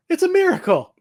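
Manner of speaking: high, loud mock amazement; mock miracle. Widow pretends she can suddenly walk, high mock amazement. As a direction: fake a sudden cure, hammed up. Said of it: "She's like".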